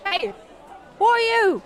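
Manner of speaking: shouting at an eye peering through the gap; yelling. Acted. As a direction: call out sharply at the stranger. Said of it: "Rose yelled".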